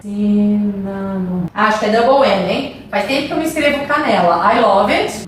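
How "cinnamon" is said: slowly stretched cinnamon; one long, low, drawn-out word. Shown as a demonstration. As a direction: say it slowly, stretched out as you write. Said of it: "Deixa eu escrever aqui".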